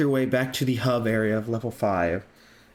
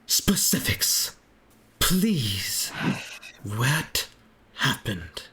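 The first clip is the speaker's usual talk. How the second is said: whispered plea; 2 semitones lower, much slower than usual. Acted. Worried character asks.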